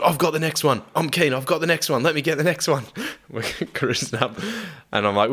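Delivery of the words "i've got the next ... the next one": higher, keen mate begging for a turn; eager Tomo. Retold or imitated. Mate retelling Tomo begging for the next kill, eager and higher. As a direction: pitch it up and beg eagerly. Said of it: "He's like".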